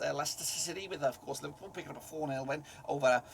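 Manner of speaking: attempted Liverpool Scouse accent. Football tipster previewing the Mersey derby, trying a Scouse accent. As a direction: have a go at a put-on Scouse accent. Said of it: "try and do it in a Scouse accent".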